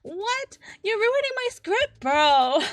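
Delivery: high and loud, playfully indignant; mock outrage. To friend who forgot the song, mock indignant. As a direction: act offended, hamming it up.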